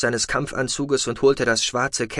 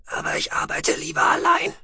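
a breathy, half-whispered alien voice; Shallowayne's voice. A narrator's reading. Shallowayne telling Tech it is too late, in a hushed alien whisper. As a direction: hold him in a hushed, husky whisper.

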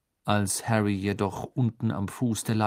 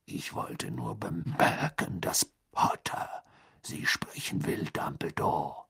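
slow, rough, half-whispered growl; gruff growler; 2 semitones lower, 4 dB quieter, slower than usual. A narrator's reading. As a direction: rasp the news out unhurriedly.

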